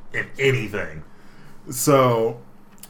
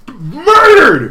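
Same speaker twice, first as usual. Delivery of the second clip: much higher and louder laughing yell; gleeful shout; 14 semitones higher, 13 dB louder than usual. Real, not acted. Reacting to a brutal callout, a laughing triumphant shout. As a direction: crow it out, delighted. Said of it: "And I was like".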